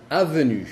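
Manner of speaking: avenue with a clear n; French avenue, n sounded; French avenue, not nasal. Shown as a demonstration. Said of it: "ya no se dice en".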